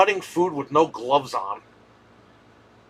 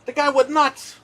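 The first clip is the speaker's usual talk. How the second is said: much higher, heated disbelief; incredulous outburst; 12 semitones higher than usual. Real, not acted. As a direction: blurt it out in shock at how crazy he was. Ranting about the deli owner.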